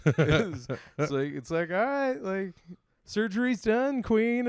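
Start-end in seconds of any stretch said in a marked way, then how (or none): 1.7-4.5 lightly voiced patient, much higher. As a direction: imitate a patient out of surgery in a high pitch. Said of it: "there ain't no tone".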